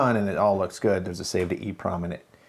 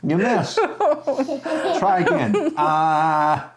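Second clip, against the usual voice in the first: playful teasing; 10 semitones higher, 5 dB louder, much slower than usual. Real, not acted. Teasing the child who throws rings.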